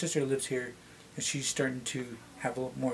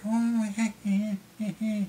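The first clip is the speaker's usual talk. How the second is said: imitating kids laughing; 9 semitones higher, 6 dB louder, slower than usual. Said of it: "you can hear it from the kids".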